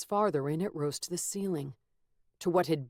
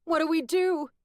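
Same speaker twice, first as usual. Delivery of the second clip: panicked; 12 semitones higher, 7 dB louder than usual. Acted. Frightened crowd asks him.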